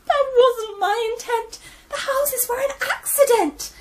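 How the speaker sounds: high, loud, tearful protest; Gran crying. Acted. Gran pleading with the piglets, tearful and high. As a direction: cry it out, voice cracking up high. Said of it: "Gran cried".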